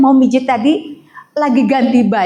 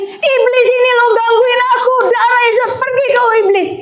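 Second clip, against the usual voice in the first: high, hammed-up rebuke of the devil; mock devil-rebuking believer; 11 semitones higher than usual. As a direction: parody a believer blaming Satan. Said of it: "Saya juga seperti itu".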